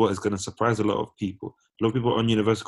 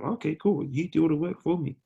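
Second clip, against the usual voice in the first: high mock exploiter voice, pitched up; 8 semitones higher than usual. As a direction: sneer it out as the users would. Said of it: "They're using them".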